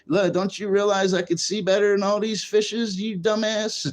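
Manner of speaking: snobby trout. Retold or imitated. Streamer voicing a trout mocking anglers, high and scornful. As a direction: sneer at the angler as a smug trout. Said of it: "That's what that trout's saying".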